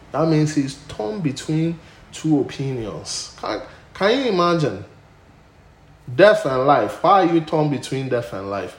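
loud, soaring preacher's voice; full-force preaching. Acted. Pastor thunders to the church about Paul, loud preaching. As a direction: preach it out to the congregation.

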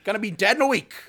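high, loud, manic shouting; Chris Farley impression. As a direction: pump it up, yell it out peppy. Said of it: "Chris Farley".

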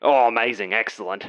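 put-on pleased boss in a dubious Greek accent; hammed-up, unconvincing Greek accent; bad Greek accent. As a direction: approve in a botched Greek accent. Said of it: "I intend on doing this accent".

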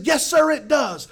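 slow, high, loud agreement; excited shouted reply. Retold or imitated. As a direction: shout back emphatically.

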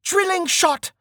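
high, forceful skill call; shouted battle call. A narrator's reading. Archer shouting his skill at bandit leader. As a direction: yell out the move, pitch way up. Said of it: "Stint shouted".

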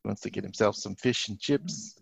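put-on a poor stab at a London accent; bad English accent. Joking about a player coming to London, bad English accent. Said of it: "terrible attempt at an accent".

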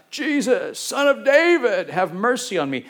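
a lifted plea, not yet a shout; calling out. Retold or imitated. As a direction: call out to Jesus from the roadside. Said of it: "He calls out".

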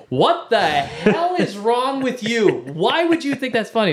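high, drawn-out outraged scolding; acted maternal outrage, stretching the words; mock-furious mom. Furious mom takes the phone.

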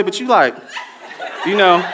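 ordinary talk, nothing marked

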